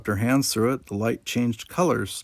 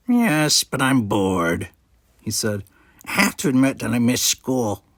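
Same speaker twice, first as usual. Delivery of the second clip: put-on nasal-free odd Ferengi kid voice; a scheming little alien; sly Ferengi boy; 3 dB louder than usual. A narrator's reading. Nog suggests eating at his uncle's bar, a sly Ferengi kid voice.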